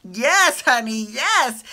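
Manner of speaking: loud, slow yes honey cheer. Real, not acted. Fan cheering Jack on, loud and drawn out. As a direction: egg him on, savoring it.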